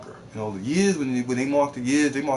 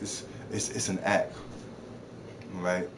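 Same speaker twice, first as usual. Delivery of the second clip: ordinary talk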